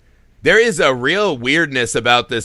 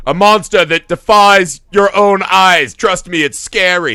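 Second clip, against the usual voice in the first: a lightly hammed-up, louder horror narrator, slowed; mock Lovecraft narrator; 4 semitones higher, 5 dB louder than usual. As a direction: parody pulp horror prose, half-serious. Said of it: "That's how Lovecraft describes every monster".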